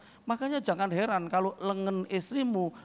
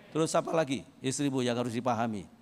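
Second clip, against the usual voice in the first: ordinary talk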